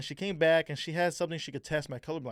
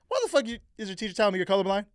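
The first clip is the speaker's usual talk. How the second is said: louder, higher, fast scolding parent; mock angry parents; 6 semitones higher, 7 dB louder, faster than usual. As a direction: parents on him after the call, fast and louder. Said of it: "my parents are like".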